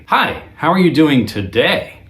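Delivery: "Hi" pitched high and punched, rising at the end; bright high "Hi", then lift "today?" upward; another inflection. Shown as a demonstration. Guitar teacher giving a third inflection, high "Hi" and rising. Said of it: "Or I could even say".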